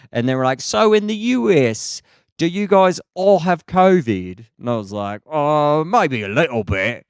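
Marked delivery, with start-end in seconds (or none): 0.6-4.5 put-on high-pitched London girls' voice; London accent. Two little London girls asking the comedian, high London accent. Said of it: "I love the London accent". 5.3-7.1 put-on London accent in reply; mimicked London accent. Comedian answering London girls, helplessly copying their London accent. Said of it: "Do an impression of them".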